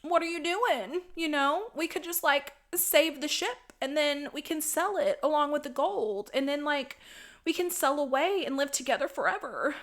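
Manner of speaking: a hint of a scheming boyfriend talking her round; mock Dodge voice. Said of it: "And he's like".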